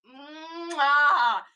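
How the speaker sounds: smacked kiss sound, drawn out; loud chef's kiss. Real, not acted. Streamer praising a shirt, loud chef's kiss. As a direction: kiss your fingers like a chef.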